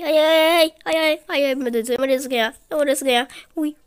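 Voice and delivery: ordinary talk, nothing marked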